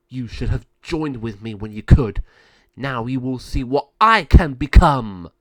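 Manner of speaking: loud threat building to a boast; menacing villain. Acted. Malvado threatening Mariana before transforming, menacing. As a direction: swell with power toward the last words.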